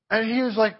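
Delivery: usual speaking voice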